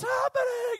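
high, stretched-out shout; shouting over wind. Acted. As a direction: yell it across a storm. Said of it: "You have to shout".

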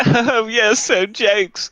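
high, plummy posh London drawl; posh London accent. Retold or imitated. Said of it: "sounds like a very posh part of London going".

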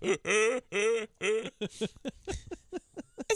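high, drawn-out fake laugh; practiced nerd laugh. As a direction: put on a goofy rehearsed laugh. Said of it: "He's practicing his laugh".

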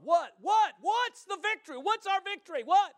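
high, loud, excited preacher shout; shouted rallying questions. Real, not acted. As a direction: fire the question at the congregation. Rousing shouted questions to congregation.

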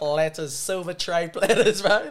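giggling through mock-posh platter talk; laughing. Real, not acted. Friend laughing at fancy catering while telling the story. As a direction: laugh while describing silver tray platters.

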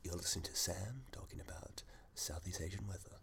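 whispered mock announcer aside; mock radio announcer. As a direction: hush it like a radio host aside.

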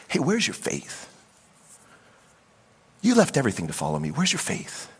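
soft, gentle questioning; not angry. Acted. Jesus to his scared disciples, gentle and understanding. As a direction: ask it softly, with understanding. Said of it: "I don't think he says it angry".

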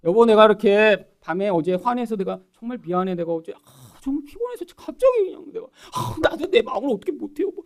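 halting, sighing mock apology; acted contrition with sighs between phrases. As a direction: make pleading excuses to a spouse, hammed up. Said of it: "이렇게 하면".

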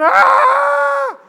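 long, high, loud scream; imitated screaming kid, sustained at full volume; child's scream. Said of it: "he starts screaming".

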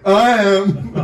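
imitating Diane. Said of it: "She's like".

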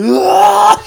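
very high, very loud sneeze yell "Ah!"; imitated sneeze. Mimicking the man's sneeze heard across the store. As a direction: let the sneeze explode. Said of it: "I hear him going".

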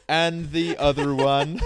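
slow, loud stern command; a hint of a stern principal, drawn out; Principal Skinner voice. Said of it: "He goes".

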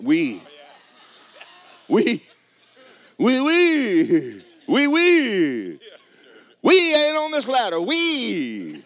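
high, loud hammed-up French accent on "We"; mock French. Husband teasing his wife about her French, hammed-up French accent. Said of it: "sometimes she becomes French".

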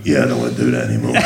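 low, fast, laughing grumble; fed-up tough-guy mutter, fast and low; Statham giving up. Retold or imitated. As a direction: mumble it low and quick, fed up. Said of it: "literally just gives up on it".

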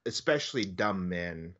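ordinary delivery

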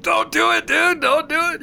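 very high, squeaky imitation of pleading; squealed mock panic; mock frantic warning. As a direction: imitate friends frantically waving someone off. Imagines players waving a friend off.

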